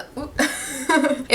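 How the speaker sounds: short soft laugh; real laugh. Real, not acted. Storyteller tripping over her retelling, real laugh. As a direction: break off into a little laugh.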